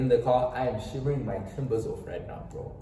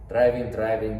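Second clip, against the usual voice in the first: normal talking voice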